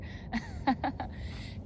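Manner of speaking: airy, breathy little laugh; light chuckle. Real, not acted. Vlogger amused by a train that goes forever, light chuckle.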